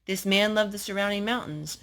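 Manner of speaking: ordinary delivery, nothing marked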